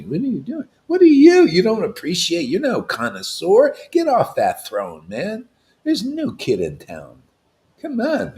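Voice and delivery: high, loud outburst at a rival guru; scornful preacher dressing down a pretender; indignant challenge. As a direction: cry out in outrage, then bite off the order. Preacher confronting an imagined guru.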